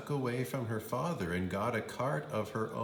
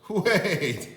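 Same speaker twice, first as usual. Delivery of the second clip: urgent wait; 9 semitones higher, 8 dB louder than usual. Acted. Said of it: "said the father".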